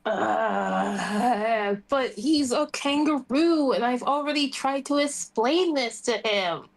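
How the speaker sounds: ordinary delivery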